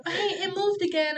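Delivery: high nurse voice through a laugh; mock nurse. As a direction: imitate the nurse, half laughing.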